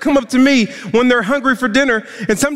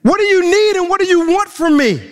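exasperated widow; 4 semitones higher than usual. Acted. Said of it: "and saying".

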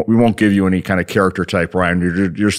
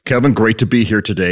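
normal talking voice

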